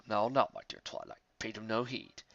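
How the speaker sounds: put-on soft low old mentor; calming. Star Swirl calming angry Twilight. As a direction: hold her back gently.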